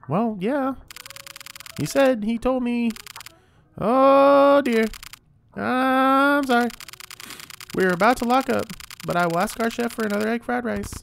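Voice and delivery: high, bright-eyed waiter voice pitched well up; shop staff voice. Acted. Takeaway staff apologizing to the customer, in a high role voice. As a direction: play the friendly staff member, pitched high.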